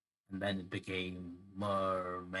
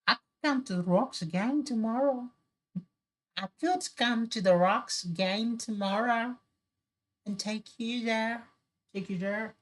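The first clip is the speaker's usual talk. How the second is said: very high put-on falsetto mermaid; hammed-up high-pitched mermaid, mock Irish; 13 semitones higher, 4 dB louder than usual. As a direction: speak far above your range as a mermaid. Mermaid speaks in the folktale.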